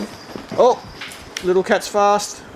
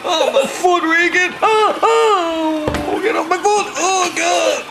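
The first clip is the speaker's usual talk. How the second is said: alarmed wailing; 7 semitones higher, slower than usual. Pretend. Foot is being run into.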